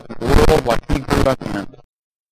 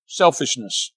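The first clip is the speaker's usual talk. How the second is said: usual speaking voice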